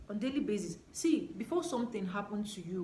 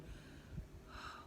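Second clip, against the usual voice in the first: breathy sigh; 15 dB quieter than usual. Real, not acted. Feels presence while talking.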